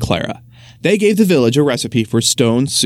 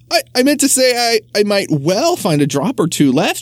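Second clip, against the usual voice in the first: higher, stumbling cover-up; stammering excuse; 7 semitones higher than usual. Acted. Butcher's wife stammering, higher, covering her slip. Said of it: "she explained".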